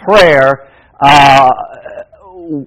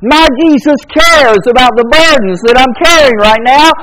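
higher, rhythmic affirmations; cadenced pulpit litany; preaching; 6 semitones higher than usual. Acted. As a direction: chant the refrain to lift them. Repeated affirmations to congregation.

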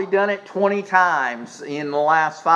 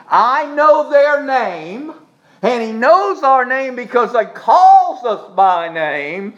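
high, raised voice with stretched words; full-force preaching; 7 semitones higher, 4 dB louder than usual. Real, not acted. Preacher assuring congregation God knows their name, full-force preaching. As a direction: proclaim it, stretching key words.

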